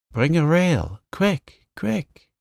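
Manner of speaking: high, urgent call for help; urgent cry. A narrator's reading. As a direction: shout it up high in a panic. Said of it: "Laurie's voice cried out".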